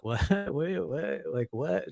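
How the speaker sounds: high, hushed stunned disbelief; incredulous. Retold or imitated. Answering the man who says he put shit on him, softly stunned. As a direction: ask it in quiet, high confusion.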